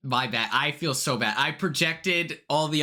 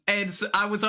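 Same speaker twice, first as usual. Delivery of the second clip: usual speaking voice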